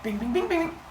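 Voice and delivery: playful lyre-plucking bing; plucking noise. Retold or imitated. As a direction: strum an imaginary lyre with your voice. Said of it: "And he's like".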